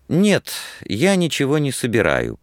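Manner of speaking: plain reading voice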